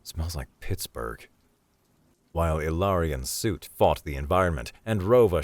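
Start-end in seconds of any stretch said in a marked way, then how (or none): 0.0-1.3 low, slow, soft mutter; muttering to himself. Acted. Danny tastes the rain and comments to himself, muttering. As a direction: grumble it under the breath, unimpressed. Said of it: "He muttered to himself".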